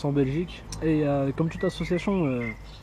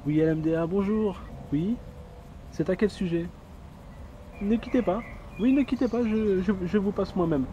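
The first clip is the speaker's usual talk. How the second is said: high, polite receptionist voice; customer service voice; 4 semitones higher than usual. Acted. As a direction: answer like a phone operator. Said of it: "Présentation du standardiste". (voix de standardiste polie et aiguë)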